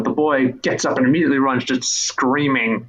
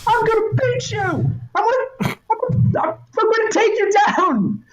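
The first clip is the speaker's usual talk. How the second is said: put-on very high, insistent boy voice; a kid stamping his foot for a duel; boy hero challenge. Boy demanding the figure fight him, high and insistent.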